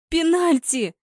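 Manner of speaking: high-pitched, joyful shriek. A narrator's reading. Anya seeing the referee give a penalty, shrieking. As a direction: shriek it in excitement. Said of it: "визгом заорала Аня".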